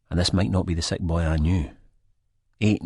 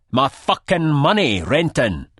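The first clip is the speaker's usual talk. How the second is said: much higher, louder, slow snarl; 10 semitones higher, 6 dB louder, slower than usual. A narrator's reading. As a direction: spit it right in his face. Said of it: "snarls in my face".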